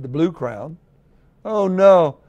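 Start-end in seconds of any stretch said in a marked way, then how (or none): 1.4-2.2 loud high cry of dismay. A narrator's reading. Unicorn watching his colors run, loud cry of dismay. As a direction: cry out in alarm. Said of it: "cried the unicorn".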